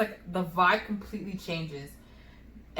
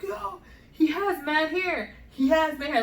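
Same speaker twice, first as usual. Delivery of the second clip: high, drawn-out marvel; excited imitation of a new dad; retold amazed husband; 7 semitones higher than usual. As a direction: stretch it out high, acting amazed. Husband marvels at baby's hair.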